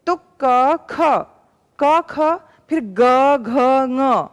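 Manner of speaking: क, ख said plainly, then ग, घ with a voiced buzz; consonants demonstrated. Shown as a demonstration. Teacher repeating the consonants for pupils to try. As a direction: say क ख, then ग घ ङ, letting the voicing be felt. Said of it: "बोल के देखिए".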